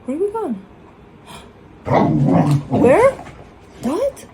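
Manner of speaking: high, gasping tease about going out; mock excited gasp. Owner winding up her dog with mock excitement. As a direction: hype the dog up with fake excitement. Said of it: "I'm fucking with him majorly".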